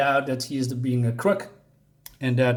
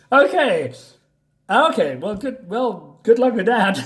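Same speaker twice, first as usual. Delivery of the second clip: amused okay, pitched higher; laughing reaction; 8 semitones higher, 5 dB louder than usual. Real, not acted. Mocking the hero's promise, laughing. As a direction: laugh through the sarcasm.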